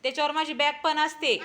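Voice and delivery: ordinary talk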